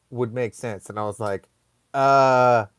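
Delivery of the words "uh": slow, hesitant guess, tipping into laughter; halting stoned reply, rising at the end; clueless answer. Retold or imitated. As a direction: offer it as an unsure question. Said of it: "I'm trying to think of an answer".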